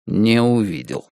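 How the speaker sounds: ordinary delivery, nothing marked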